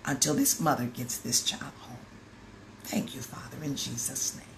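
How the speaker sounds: hushed prayer. Retold or imitated. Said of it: "I said".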